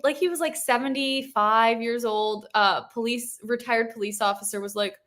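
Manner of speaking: normal talking voice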